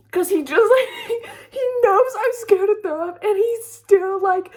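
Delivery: high, loud, laughing through the words; real laughter breaking into the words; laughing disbelief. Real, not acted. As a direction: crack up while telling it.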